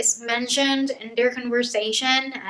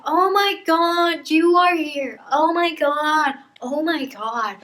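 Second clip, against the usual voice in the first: acted excitement; 5 semitones higher, 3 dB louder than usual. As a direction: gush as if greeting a long-lost friend. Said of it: "She's so excited".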